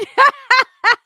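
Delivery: loud high laugh that falls away. Real, not acted. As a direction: burst out laughing and let it drop. Reacting to a chat comment.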